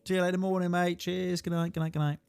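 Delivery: cheery goodnight. Retold or imitated. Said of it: "And I was like".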